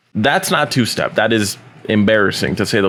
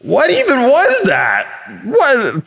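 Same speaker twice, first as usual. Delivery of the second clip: aghast, baffled squeal; stunned disbelief; 3 semitones higher, slower than usual. Real, not acted. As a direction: react in total shock at the noise. Disbelief at awful exhaust.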